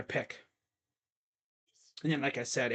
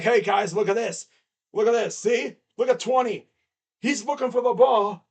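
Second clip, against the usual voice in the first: teasing, louder call to the room; mock trolling; 5 semitones higher, 6 dB louder than usual. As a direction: point him out to the guys, loud and gleeful. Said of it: "I'm like".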